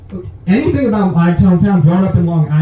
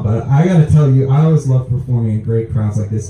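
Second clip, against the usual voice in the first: ordinary talk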